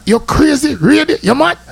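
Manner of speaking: high, disbelieving outburst of questions; mock astonished voice, pitched well up; incredulous. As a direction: react like the idea is madness. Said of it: "Me I go talk".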